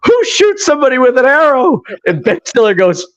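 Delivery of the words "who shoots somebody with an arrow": high-pitched, incredulous outburst; hammed-up wounded indignation; indignant Christopher Walken. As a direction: demand an answer in mock outrage. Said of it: "Chris Walken goes".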